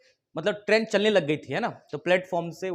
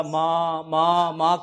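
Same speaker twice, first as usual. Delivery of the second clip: mock wailing; 2 semitones lower, 3 dB louder, much slower than usual. Bereaved boy cries out for his mother, mock wail. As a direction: imitate a mild, monotone wail. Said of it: "करके चिल्लाने लग गया".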